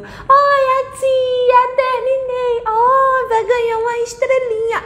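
put-on very high, loud little-kid voice; childish high-pitched brag to the teacher. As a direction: squeal it like a proud child showing homework. A criança faz o deverzinho.

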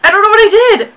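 high panicked yell; panicked shout. Real, not acted. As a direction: blurt it out in a panic. Panicked after failed jump.

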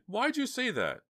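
high, loud dismayed question; high-pitched, upset questioning. A narrator's reading. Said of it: "said Dina, dismayed".

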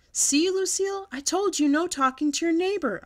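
put-on loud, high kid holler. A narrator's reading. Girl hollers at her classmate about no talking. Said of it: "I hollered".

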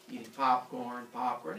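normal talking voice

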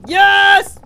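loud, high cheer; triumphant shout. Real, not acted. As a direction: burst out in victory. Celebrates in the game.

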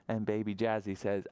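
usual speaking voice

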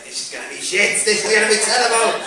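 mock an unimpressed punter; sceptical audience member. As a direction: build up the disgruntled complaint. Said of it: "Got a guy there who's arms folded like".

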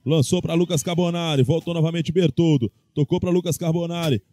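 ordinary delivery

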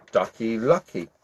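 usual speaking voice

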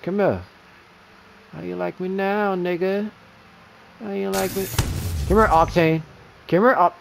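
loud, mocking call at the enemy; taunting shout. Real, not acted. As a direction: bellow it like you're on the hunt.

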